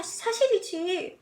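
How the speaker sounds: ordinary delivery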